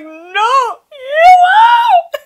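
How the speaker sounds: high shrieking sob-wail; hammed-up crying scream. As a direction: shriek it through tears. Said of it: "I'm like".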